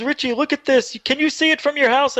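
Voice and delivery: high, urgent imitation of a father; excited dad. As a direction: urge him to look, pitch well up. Dad urging son to look.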